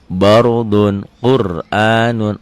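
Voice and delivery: bardun Qur'anun chanted slowly in recitation voice; recited Arabic. Shown as a demonstration. Teacher reciting bardun Qur'anun, slow recitation. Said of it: "Ro-nya mati".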